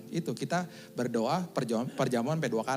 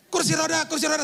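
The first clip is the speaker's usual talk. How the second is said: high, loud urgent call for a wheelchair; 14 semitones higher, 6 dB louder than usual. Retold or imitated. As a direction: shout it across the ward like a flustered nurse. Nurse calling out in panic.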